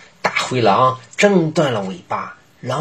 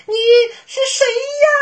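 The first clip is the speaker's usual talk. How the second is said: put-on very high, small child voice; tiny bunny calling through the door; little bunny voice; 4 dB louder, much faster than usual. Little bunnies ask through door.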